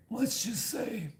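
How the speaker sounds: put-on menacing New York mob accent, drawn out; slow, ominous New York gangster accent; mafioso accent. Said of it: "He's like".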